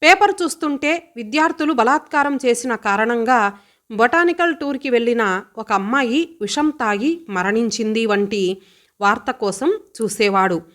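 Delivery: usual speaking voice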